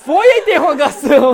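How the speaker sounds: grito agudo, às gargalhadas; protesto aos gritos. Real, not acted. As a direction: berre indignado, morrendo de rir. Protesta rindo ao perder no jogo. (gritando e rindo ao protestar)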